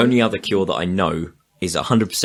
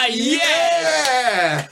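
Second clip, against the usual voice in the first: excited cheer. Real, not acted. Cheering the violin joke.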